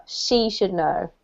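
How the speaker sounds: SHE should know, slow, weight on she; stress on SHE. Shown as a demonstration. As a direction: start strong on she. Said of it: "put the emphasis on her".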